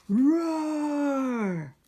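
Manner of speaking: loud, high hammed-up roar; lion roar. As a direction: roar it out big like a lion for kids. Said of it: "The lion goes".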